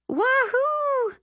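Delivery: very high, loud whoop; cheering whoop. Acted. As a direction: let out a joyful yell. Cheering mom's decision.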